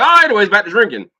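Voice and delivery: loud, high burst of revelry; mock cheery shrug-off. Drinkers moving on after a somber moment, loudly cheery. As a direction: suddenly shake off the gloom, loudly.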